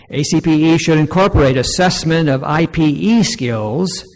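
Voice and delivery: loud, projected reading of a formal statement; formal read-aloud. Real, not acted. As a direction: read it out firmly to a room. Reads numbered recommendation aloud to audience.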